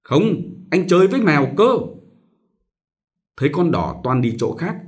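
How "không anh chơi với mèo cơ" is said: higher, louder, fast spoiled whine; whiny child. Acted. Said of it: "giãy giụa hai cẳng nhăn nhó".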